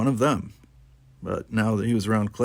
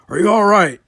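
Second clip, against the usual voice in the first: loud high shout over noise; 10 semitones higher, 10 dB louder than usual. Acted. Zach shouting to Worf amid the attack, loud. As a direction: yell it across the room. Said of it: "he shouted".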